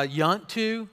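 "yunt to" run together as Southern slang; Southern slurred contraction. Shown as a demonstration. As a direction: squash "do you want" into "yunt". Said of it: "Ever heard that somebody say".

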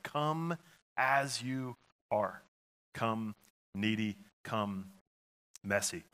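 slow, soft welcoming call; tender invitation. Acted. Pastor gently inviting the church, slow and soft. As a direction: invite them in gently, taking time. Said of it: "the counsel of the psalmist".

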